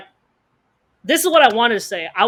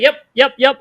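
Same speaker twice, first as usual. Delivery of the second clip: loud, higher, repeated yeps; strained agreement; 3 semitones higher, 7 dB louder than usual. Retold or imitated. Player going along with his fiancée, strained agreement. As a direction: agree too readily while still heated.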